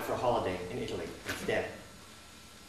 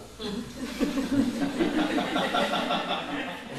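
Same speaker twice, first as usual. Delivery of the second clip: imitated laugh; 12 semitones higher than usual. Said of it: "He laughed".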